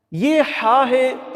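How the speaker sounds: हा slowly from the throat; throat haa. Shown as a demonstration. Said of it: "हल्क से अदा करेंगे". (हा हल्क से अदा)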